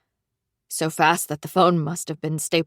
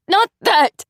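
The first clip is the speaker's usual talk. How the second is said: high, loud exasperated yell; 13 semitones higher, 8 dB louder than usual. Acted. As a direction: shout it down in disbelief. Friend yelling over the phone.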